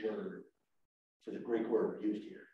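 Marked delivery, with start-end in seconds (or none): none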